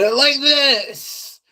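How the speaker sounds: high, drawn-out sarcastic voice; demonstrated sarcasm. Shown as a demonstration. Streamer showing viewers how the sarcasm was said, exaggerated sarcastic. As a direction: stretch it out high for obvious sarcasm. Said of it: "make sure you guys knew that was sarcastic".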